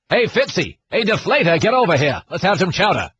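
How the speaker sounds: mock Boston fanboy accent; broad Boston-accent send-up. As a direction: ham up a Boston local cheering on the team. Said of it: "in a Boston accent".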